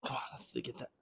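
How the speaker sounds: strained whisper mid-rep; breathy push through the last rep. Real, not acted. Whispered during the effort of a set.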